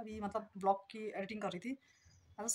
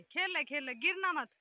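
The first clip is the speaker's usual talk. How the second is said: calling out; 6 semitones higher than usual. Real, not acted. Calling to playing daughter.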